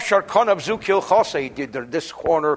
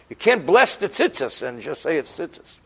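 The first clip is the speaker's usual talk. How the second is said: normal talking voice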